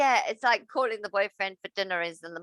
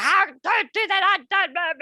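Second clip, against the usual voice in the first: mock kid yelling; 10 semitones higher, 4 dB louder than usual. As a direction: mimic the child screaming at the game. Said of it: "literally yelling and screaming".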